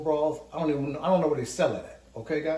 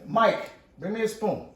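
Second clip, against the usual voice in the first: higher, louder and slow, called across the room; calling out; 6 semitones higher, 5 dB louder than usual. Real, not acted. Host calling to absent helper Mike for a spoon, loud call. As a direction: call out to someone in another room.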